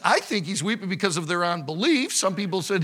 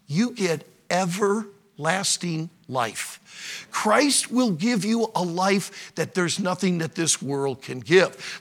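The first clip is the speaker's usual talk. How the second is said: ordinary talk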